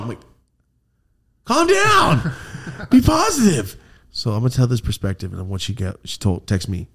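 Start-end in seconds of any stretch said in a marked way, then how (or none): 1.4-3.8 high, loud mock yell; panicky, high-pitched exasperation; exasperated plea. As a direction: screech it as comic pleading. Mock plea to alarmed mom.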